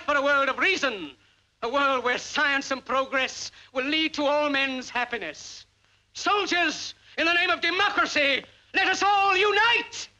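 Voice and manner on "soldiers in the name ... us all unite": rallying cry. Acted. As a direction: call the soldiers to unite. Final rallying cry of speech.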